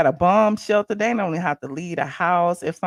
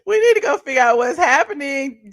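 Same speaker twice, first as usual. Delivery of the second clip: laughing; 5 semitones higher, 6 dB louder than usual. Real, not acted.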